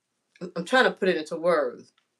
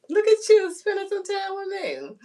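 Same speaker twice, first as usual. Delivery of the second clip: touched, near tears; 12 semitones higher, 7 dB louder than usual. Real, not acted. Touched by viewers.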